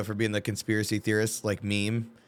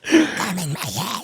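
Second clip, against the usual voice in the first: mock cartoon voice; 7 semitones higher, 3 dB louder, much slower than usual. Mock Mickey voice.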